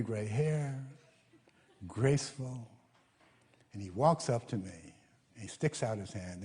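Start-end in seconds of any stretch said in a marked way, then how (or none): none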